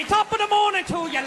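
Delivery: mock Irish cheer, sung out high; leprechaun greeting. Joking he is a leprechaun strolling past them, mock Irish greeting.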